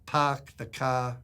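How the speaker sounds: put-on slow New England accent with dropped r's; "pahk the cah" New England speech. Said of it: "he was from New England".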